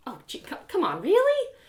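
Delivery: rising high whine; whiny protest. Acted. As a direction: plead your way out.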